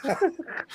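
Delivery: high giggle; high laugh. Real, not acted. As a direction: giggle at your own line. Laughs at own joke.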